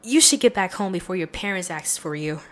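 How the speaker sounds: normal talking voice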